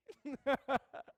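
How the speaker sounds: a high, bright burst of laughter. Real, not acted. Laugh after nurse quote.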